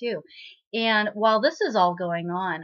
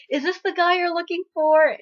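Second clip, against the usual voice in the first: high, loud eager question; mock excitement; 10 semitones higher, 8 dB louder than usual. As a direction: ask it gleefully, pitched right up.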